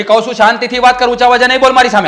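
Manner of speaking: loud, high fast warning; husband ordering wife to calm down, shouting; angry shout. Acted. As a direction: bark the command at her.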